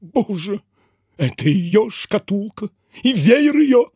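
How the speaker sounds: theatrically heartbroken; tearful sob. A narrator's reading. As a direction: choke it out slowly, near tears. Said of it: "всхлипнул".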